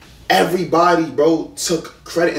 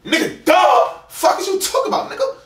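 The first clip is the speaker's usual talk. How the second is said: much higher, incredulous outburst; exasperated retort; 13 semitones higher than usual. Real, not acted. Boyfriend defending his content work to girlfriend, exasperated retort. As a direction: fire it back in high-pitched indignation.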